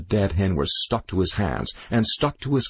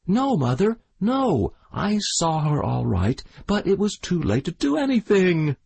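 put-on high, pleading boyish protest; desperate protest; 9 semitones higher than usual. A narrator's reading. The boy protests to his mother. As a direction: protest and plead your innocence.

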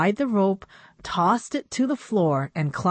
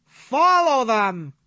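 louder, slow, drawn-out command; rally the others to chase; urgent cry; 6 semitones higher, 5 dB louder, slower than usual. A narrator's reading. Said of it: "cried Dad".

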